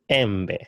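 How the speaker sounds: "embe" said em-beh, short e sounds; Swahili e vowel. Shown as a demonstration. Teacher modelling the Swahili word for mango.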